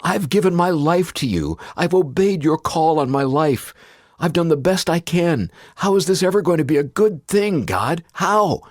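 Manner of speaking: high, loud, desperate pleading; anguished cry to God. Retold or imitated. Grieving father crying out to God in his car, anguished loud plea. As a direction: beg heaven for an answer through grief. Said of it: "I cried out loud to God".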